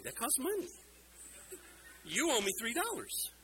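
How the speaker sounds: preacher teasing the boy who broke the pot, mock indignant; mock indignation. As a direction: play hurt about the broken pot, all in fun. Mock complaint after pot breaks.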